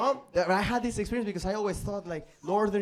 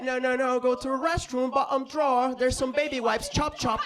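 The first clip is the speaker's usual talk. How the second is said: put-on relentless bossy girlfriend; 6 semitones higher than usual. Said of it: "But she was relentless".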